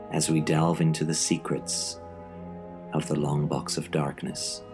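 spooky announcer intro; horror host. Acted. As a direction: invite listeners in, creepily. Horror host intro over music.

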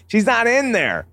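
put-on high, whining kid voice; whiny voice. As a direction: whine it like a sulky little boy. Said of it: "open his faceplate and just whine".

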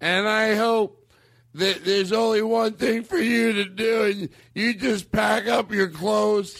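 put-on high, slow drunken tirade; drunk angry lament. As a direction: lay it on like a bitter drunk. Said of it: "I'm gonna quote this right here".

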